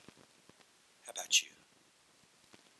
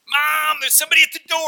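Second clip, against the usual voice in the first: put-on high, loud child calling out to Mom; a kid yelling for Mom from the hall; excited child; 15 dB louder than usual.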